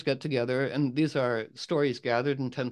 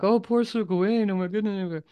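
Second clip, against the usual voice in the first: gossiping court ladies; 8 semitones higher than usual. Retold or imitated. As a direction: cluck with fake sympathy like gossips. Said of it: "they're like".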